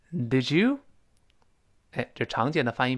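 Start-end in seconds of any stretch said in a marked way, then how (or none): 0.1-0.8 "Did you" as "dij-you", d blended into y, rising at the end; model did you. Shown as a demonstration. Teacher repeats the phrase for students to echo, blending. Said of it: "跟老师再读一遍".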